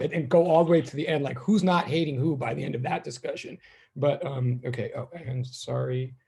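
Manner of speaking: normal talking voice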